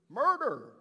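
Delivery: high, loud cry of the word Murder; outraged shout; shouted accusation. Acted. Governor stresses the crime.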